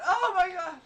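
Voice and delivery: very high, loud mock cry; imitated ecstatic scream. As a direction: scream it out in imitation of a lover, laughing. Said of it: "She said".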